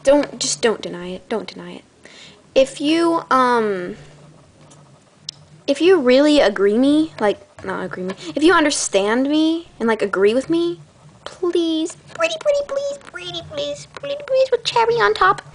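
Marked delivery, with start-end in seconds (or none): 1.3-1.8 unvoiced, soft, teasing whisper. Shown as a demonstration. Telling viewers not to deny it, in a whisper. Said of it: "repeated with whisper". 11.2-15.4 much higher pleading sing-song; pleading sweetly. Acted. Begging viewers to comment, high and childlike.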